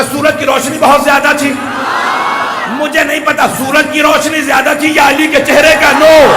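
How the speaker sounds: high, loud devotional cry; ecstatic shout. Real, not acted. Preacher praising Ali's radiance to congregation, shouting. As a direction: soar on the praise of Ali's light.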